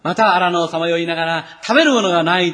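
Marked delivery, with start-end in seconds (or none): 1.6-2.5 mock complaint. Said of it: "と言ってつぶやき".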